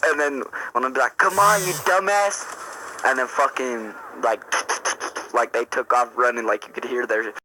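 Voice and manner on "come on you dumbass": high-pitched exasperated call; exasperated mock yell. Teller to his fleeing homies, exasperated mock yell. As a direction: scold a clumsy friend, pitched well up. Calling after clumsy fleeing friends.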